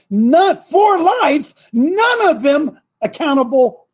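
sudden high, loud, slow outcry; outraged cry. Real, not acted. Host railing at the Supreme Court, outraged slow cry. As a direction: fling it out in disbelief.

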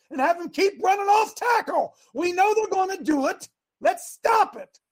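high, worked-up coach's rant; seemingly mad. Acted. Coach to his assistants at halftime, heated shouting. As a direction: fire orders at the staff, exasperated. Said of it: "I'm not mad. It just seems like it".

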